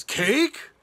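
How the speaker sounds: loud, high rising question; eager duck. Acted. Storyteller voicing Duck hearing about cake, loud and surprised. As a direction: pipe up with sudden interest.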